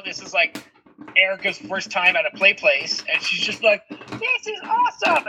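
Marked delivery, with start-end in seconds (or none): 3.9-5.3 put-on very high, slow, wide-eyed little kid voice; delighted toddler. Toddler at her first play place, high and awestruck. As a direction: coo it like a thrilled toddler.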